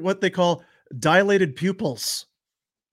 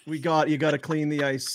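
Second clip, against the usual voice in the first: ordinary talk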